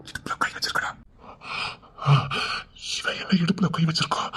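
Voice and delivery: breathy whisper of shock; soft, stunned muttering; hushed aside. Acted. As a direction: whisper it to yourself. Shocked aside, not to be overheard.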